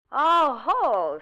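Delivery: loud knowing oh ho; caught-you exclamation; oh ho surprise. Acted. Nurse realizes Tom was moved, loud surprised oh ho.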